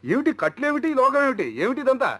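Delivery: high, stunned questions; shocked exclamations. Acted. Elder brother finds his friend tied up, asking in high shock. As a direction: recoil in disbelief at what you see.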